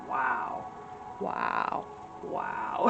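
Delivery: low, very soft wows; hushed amazement. Real, not acted. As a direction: say it low and under your breath, marvelling. Marvels at time alone.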